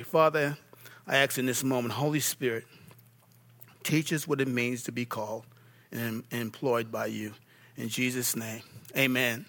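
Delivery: soft, low prayer voice; quiet prayer. Acted. Leads a closing prayer.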